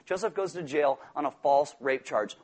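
normal talking voice

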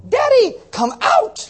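put-on very high, slow child's call at the door; little girl shouting. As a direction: yell for daddy like a little girl. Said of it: "she'd be all".

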